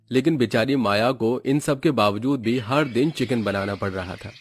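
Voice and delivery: plain reading voice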